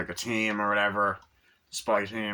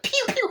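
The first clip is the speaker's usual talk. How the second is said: gunshot noises; 3 dB louder than usual. Retold or imitated. Imitating gunfire.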